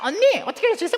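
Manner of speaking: high-pitched, garbled mocking impression; nagging sister-in-law. Sister-in-law pestering the wife, high mocking squeal.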